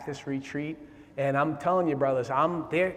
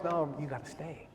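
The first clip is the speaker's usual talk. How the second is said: God's voice urging; 7 semitones higher, 3 dB louder than usual. Retold or imitated. God on the other shoulder urging him to stay, building from hush to loud. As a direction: start as a hush, then rise into a rallying plea. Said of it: "I'm hearing God in the other one".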